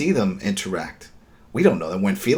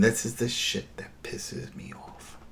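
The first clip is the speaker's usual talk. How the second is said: hushed annoyance; 7 dB quieter, slower than usual. Real, not acted. Building up to a rant.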